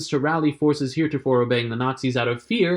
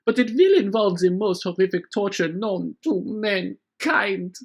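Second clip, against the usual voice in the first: sneering villain, smooth and menacing; scheming villain. Pretend. Von Turpin threatening Marius, in a sneering villain voice. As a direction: gloat over the hero's secret.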